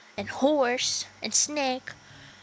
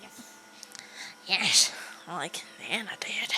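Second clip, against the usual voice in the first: low, hushed, lower than usual; eerie whisper; 5 semitones lower, 6 dB quieter than usual. Acted. Stewie whispering he will be like Nana. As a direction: whisper it like a dark vow.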